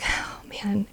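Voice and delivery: soft whisper of awe; whispered aside. Retold or imitated. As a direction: breathe it out in a whisper. Remembering the moment.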